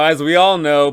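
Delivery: usual speaking voice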